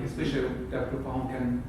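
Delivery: ordinary talk, nothing marked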